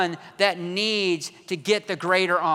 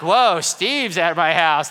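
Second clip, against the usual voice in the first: mock awe; 7 dB louder, slower than usual. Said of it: "It's like you thought".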